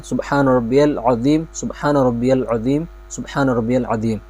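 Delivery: unhurried bowing praise, fading; calm, slow tasbih. Shown as a demonstration. Teacher repeating calm bowing praise. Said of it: "اطمینان کے ساتھ".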